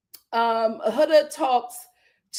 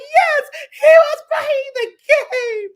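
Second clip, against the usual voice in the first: high, loud sobbing wail; mock crying wail; 13 semitones higher, 8 dB louder than usual. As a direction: sob it out heartbroken. [name] crying confirmation.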